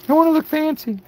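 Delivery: high falsetto fancy voice. Pretend. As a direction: squeak it out all fancy. Jokes about looking fancy.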